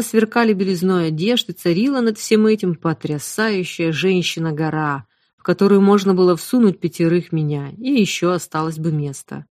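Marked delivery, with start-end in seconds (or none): none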